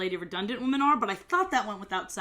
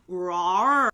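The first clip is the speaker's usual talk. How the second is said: mock rawr; 3 semitones lower, 5 dB louder than usual.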